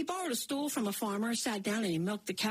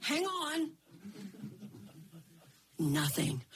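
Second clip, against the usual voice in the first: ordinary delivery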